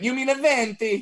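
high-pitched, affected correction; mock snooty barista. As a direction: imitate a precious barista correcting a customer. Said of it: "you're like".

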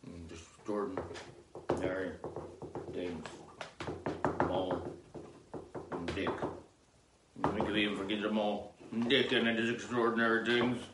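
savoring murmur. Acted. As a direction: savor each word of the name. Savoring name quietly.

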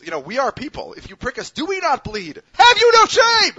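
rising oration that erupts into a high, drawn-out bellow; building theatrical shout. Retold or imitated. Subway vigilante standing up for both riders, building theatrical shout. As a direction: build from earnest plea to full mock-outraged yell. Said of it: "that one's gonna have to be normalized".

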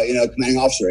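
normal talking voice

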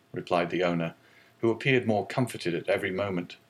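usual speaking voice

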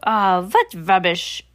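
slow, scornful witch voice; Grand High Witch scoffing, slow and harsh; scornful shout. Acted. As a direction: scoff at the nonsense slowly. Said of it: "shouted the Grand High Witch".

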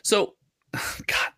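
a sighing whisper of dread; real weary whisper to himself; exasperated whisper. Real, not acted. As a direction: breathe it out, fed up. Weary exasperation before the update.

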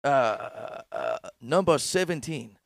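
ordinary talk, nothing marked

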